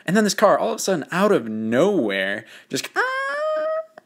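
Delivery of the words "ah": high squealing tyre screech; a car screeching in as it cuts in front. Retold or imitated. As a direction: imitate brakes screeching, way up high. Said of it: "just screeches in".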